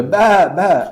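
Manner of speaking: sheep bleat. Retold or imitated. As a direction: bleat like the flock. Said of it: "sheep used to go".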